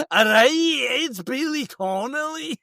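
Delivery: high put-on impressionist voice; impressionist's Billy Connolly. Mocking impressionists announcing Billy Connolly, high put-on voice. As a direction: pitch it up like a hammy impressionist. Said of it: "They always go".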